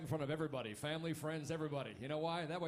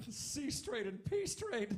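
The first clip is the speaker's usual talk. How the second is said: high, drawn-out imitation of a laughing mom; laughing hard; 8 semitones higher, much slower than usual. Mom reading her sons' names off passports, imitated helpless laughter. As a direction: squeal it slowly like mom cracking up. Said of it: "she starts laughing really loud".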